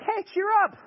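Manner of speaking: high, sing-song, glib; mock breezy cheer. Jesus to terrified disciples, mockingly breezy. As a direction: toss it off absurdly cheerful. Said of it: "And you're like".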